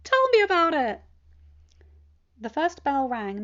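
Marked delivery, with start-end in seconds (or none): 0.0-1.0 put-on high, louder, bright-eyed teen voice; cheerful teen. Teen telling his uncle about his weekend, cheerful and high. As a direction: bounce through it like an eager kid.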